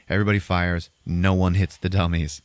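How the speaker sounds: usual speaking voice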